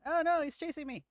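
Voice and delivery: high, loud playful panic; mock panic. Real, not acted. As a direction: squeal it out as he chases. Zombie chasing player character.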